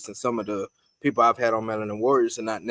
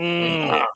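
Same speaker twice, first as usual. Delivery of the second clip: imitated baby grunt; 5 semitones higher than usual. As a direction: grunt like an angry hard-faced baby. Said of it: "They grunt".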